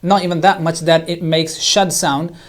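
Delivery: ordinary talk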